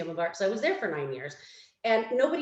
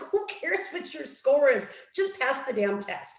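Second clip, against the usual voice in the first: imitated friend brushing off the worry, pitched up; exasperated dismissal; 5 semitones higher, 3 dB louder than usual. Friend telling a stressed test-taker to just pass, exasperated. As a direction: wave the worry away, high and impatient.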